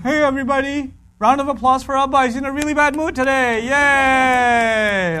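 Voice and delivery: high, bright-announcing wife, mocking; mock cheerful wife. Wife teasing husband in front of family, high mock cheer. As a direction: cheer him sarcastically. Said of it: "the wife will be like".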